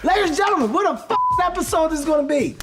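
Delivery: high, excited show opener; hyped announcer intro. Real, not acted. Host introducing Allen Iverson on the show, high hyped announcing. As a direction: announce the star with rising-to-the-occasion hype.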